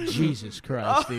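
slow, laughing exclamation; laughing disbelief. Retold or imitated. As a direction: groan it through a laugh. Dream self scolding himself.

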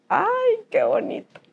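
mock-sweet exclamation dissolving into a laugh; ironic delight. Real, not acted. Meeting the doctor again, ironic delight and laughter. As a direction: say it with sly irony, then laugh.

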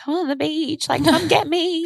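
high, pleading call to Mum; panicked plea. Retold or imitated. High pleading call, retelling the phone call to Mum. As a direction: beg Mum to come and get her. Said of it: "I was like".

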